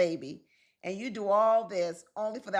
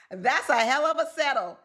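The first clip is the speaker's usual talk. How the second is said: higher, louder exclamation; loud punchline; 5 semitones higher, 6 dB louder than usual. Real, not acted. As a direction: hit the punchline hard. Punchline after laughing.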